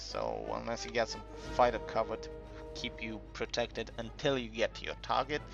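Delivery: ordinary talk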